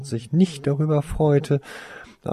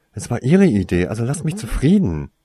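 louder defensive retort; hissed; 3 semitones higher, 7 dB louder, faster than usual. Acted. Tarsoin hitting back at the brothers, louder defensive retort. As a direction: push back hard through tension. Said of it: "zischte Tarsoin".

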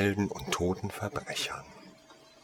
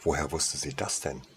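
usual speaking voice

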